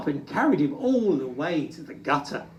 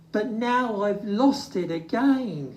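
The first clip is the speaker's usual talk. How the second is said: sniffing; 6 semitones higher than usual. Acted. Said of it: "he sniffed".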